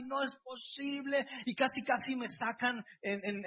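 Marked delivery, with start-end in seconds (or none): 0.0-1.3 mock daughter dismay.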